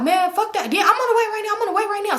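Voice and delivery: high-pitched retold exasperation; agitated phone rant. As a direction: imitate him insisting urgently on the phone. Acts out his heated phone call.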